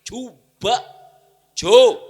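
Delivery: high loud prodding; urging shout. Acted. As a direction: egg them on loudly. Urging audience to try.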